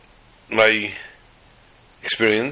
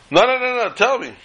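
put-on eager drunk; 13 semitones higher than usual. Drunk man pressing for town.

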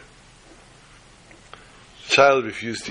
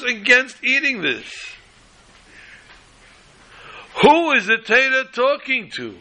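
fervent preaching; 8 semitones higher than usual. Acted. Rising preaching fervor.

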